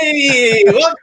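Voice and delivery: high, loud hyped YouTube intro; over-the-top YouTuber greeting; annoying YouTuber voice. Shown as a demonstration. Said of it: "without having the annoying YouTuber voice".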